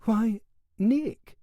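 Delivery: bewildered. A narrator's reading. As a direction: caught off guard at the door. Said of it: "she said in bewilderment".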